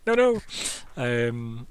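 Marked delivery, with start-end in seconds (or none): 0.1-0.4 candle panic. Acted. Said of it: "you're like".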